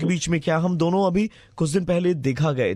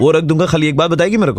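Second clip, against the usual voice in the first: much louder, insistent; loud demand; 8 dB louder than usual. Acted. Prank caller, louder, insisting she answer one question. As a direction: push her hard for one answer.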